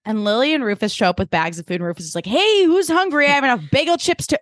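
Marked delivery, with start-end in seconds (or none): 2.2-4.4 high, loud oblivious greeting; cheery call. Retold or imitated. Rufus arriving with food, high cheery call. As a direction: call out brightly to the whole room.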